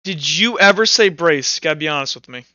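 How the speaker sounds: ordinary talk, nothing marked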